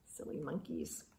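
quick hushed aside; rushed whispered aside; fast whisper. Real, not acted.

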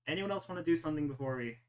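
ordinary talk, nothing marked

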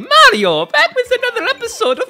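high, loud cartoon greeting; Mario. Host opens another episode as the game's hero, high cartoon voice. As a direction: kick it off in a bouncy, high-pitched character voice. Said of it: "it's me, Mario".

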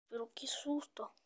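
shaken whisper. Real, not acted. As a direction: whisper after a fright. After the scare. (susurro asustado)

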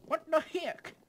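loud, very high squeal of disbelief. Real, not acted. Gamer sent somewhere unwanted, high outraged squeal.

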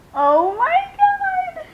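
loud, very high, drawn-out squeal; delighted shriek. Real, not acted. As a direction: shriek with joy at the reveal. First look at doll's face.

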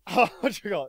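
normal talking voice

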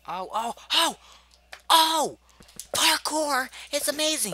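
high, loud shrieking joy; ecstatic. Real, not acted. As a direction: scream with delight. Finally clears the parkour.

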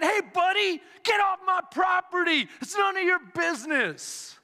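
high, irate mock homeowner; indignant shout. Homeowner to would-be rescuer, indignant high shout. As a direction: shout them off your property.

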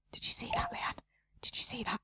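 breathless gasp, then a high whisper; gasped excited whisper. Real, not acted. To the game's Leanne, an excited gasping whisper.

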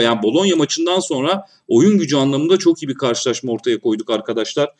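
usual speaking voice

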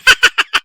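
high loud laugh; burst of laughter. Acted. Laughs at the suggestion.

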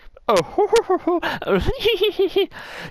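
high hammed-up villain laugh. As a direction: cackle in gleeful triumph. Villain character laughs again.

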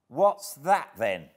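high, loud challenging retort; sharp retort. A narrator's reading. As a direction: throw it back as a loud challenge. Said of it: "I retorted".

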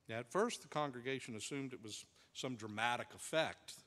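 ordinary talk

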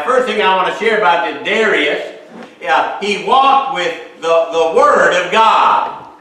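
ordinary talk, nothing marked

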